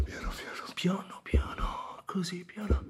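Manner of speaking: soft crying whisper; whispered plea. Acted. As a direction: barely breathe the plea through tears. Pain fading, begging softly.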